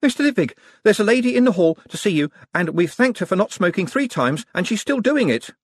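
put-on high, urgent young clerk voice; agitated messenger, pitched well up; flustered young clerk. A narrator's reading. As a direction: blurt the message out, high and loud. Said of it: "of Moist, panting".

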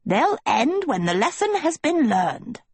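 high, swooping gnome voice; gnome calling back. A narrator's reading. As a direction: call back airily in a piping gnome voice. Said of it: "the gnome called back".

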